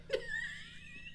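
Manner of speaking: squealing high laugh; high shriek laugh. Real, not acted. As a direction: burst into a shrill-high laugh. Laughing at husband joke.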